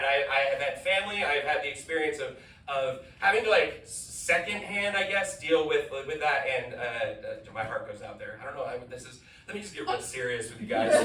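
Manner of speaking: usual speaking voice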